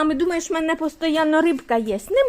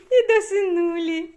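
laughing; 7 semitones higher than usual. Real, not acted. Laughing at pet.

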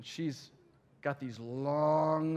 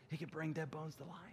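hushed redo; 2 semitones higher, 10 dB quieter than usual. Shown as a demonstration. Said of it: "you know what I mean in this context".

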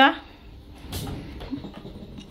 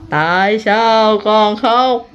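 very slow, lower, stretched-out question; drawn-out questioning; 4 semitones lower, much slower than usual. Real, not acted. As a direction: stretch every word out as you coax an answer. Repeats question slowly to crying child.

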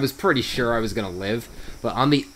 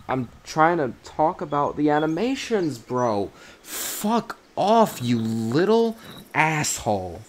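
higher, strained exasperated cursing at the game; exasperated outburst; 5 semitones higher, slower than usual. Real, not acted. Gamer cursing out the creature that interrupts his showcase, exasperated. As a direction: snap in real frustration at an attacker. Player attacked while showcasing, swears.

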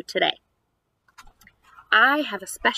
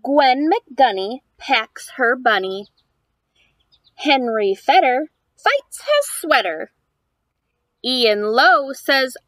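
lively, louder picture-book reading; reading to children; 6 dB louder than usual. Acted. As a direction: read the rhymes aloud to a small child. Reads picture book aloud.

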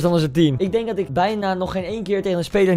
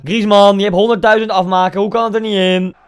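frustrated yell; 2 semitones higher, 7 dB louder than usual. Real, not acted. Yelling at player.

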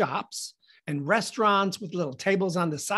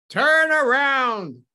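loud, high, stretched command; cop's yelled order; 6 semitones higher, 7 dB louder, much slower than usual. Acted. Cop to the naked man facing away, yelled order. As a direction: holler it slowly over a loudspeaker. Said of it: "he yells into the loudspeaker".

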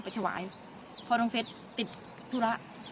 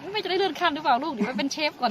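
higher-pitched, playful quote of herself; playful teasing; 6 semitones higher, 4 dB louder than usual. Retold or imitated. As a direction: joke lightly with your child, pitch lifted. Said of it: "คุณแม่พูดเล่นน่ะ". (พูดหยอกลูกเล่น ๆ เสียงสูงขึ้น)

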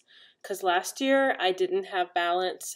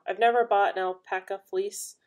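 ordinary delivery

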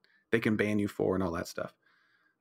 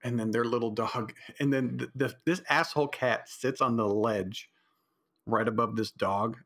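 usual speaking voice

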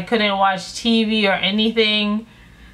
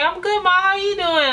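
innocent high voice; 9 semitones higher than usual. Retold or imitated. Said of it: "I'm like".

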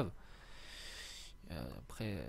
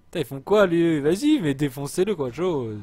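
loud, raised-pitch scolding; exasperated shouting; 5 semitones higher, 10 dB louder than usual. Real, not acted. Player berating blocked army, exasperated. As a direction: yell at useless troops to get on with it. Troops blocked, he urges them.

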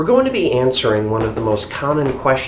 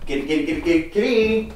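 sing-song cat call; 5 semitones higher, faster than usual. Real, not acted. Calling the cat over.

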